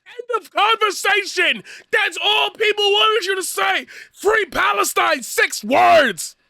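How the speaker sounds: high, loud yelling; furious shout. Real, not acted. Yelling at Natalie that six words were enough, furious shout. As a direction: scream it at her.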